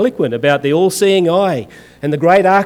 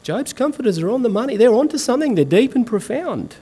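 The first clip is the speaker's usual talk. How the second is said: higher, softer, mock-sage musing; mock wise commentator; 6 semitones higher, 4 dB quieter than usual. Preacher mocking commentators who praise Job's comforters, higher hushed mock wisdom. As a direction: lightly parody a nodding scholar, higher and softer. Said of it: "nodding wisely and going".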